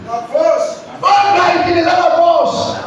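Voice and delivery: high, slow, shouted declaration; preacher declaring the church will use force, shouted; preaching shout. Acted. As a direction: proclaim it with full force.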